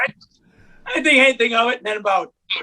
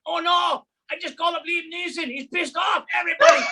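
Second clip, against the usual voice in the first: high panicked shout; hammed-up high panic; panicking scammer; 10 semitones higher than usual. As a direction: yell in mock alarm to the whole office. Scammer panicking after call.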